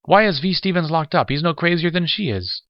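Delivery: high, loud, agitated plea; pleading, voice up high. A narrator's reading. Lamar pleading for Stevens.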